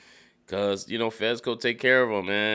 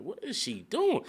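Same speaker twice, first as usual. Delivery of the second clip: high baffled question; 12 semitones higher, 3 dB quieter than usual. Real, not acted. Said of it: "I'm like".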